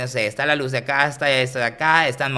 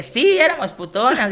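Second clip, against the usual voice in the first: much higher, louder tipsy lady playing along; giggly old lady; 12 semitones higher, 5 dB louder than usual. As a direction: squeal it like a delighted old señora. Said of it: "la señora se ríe".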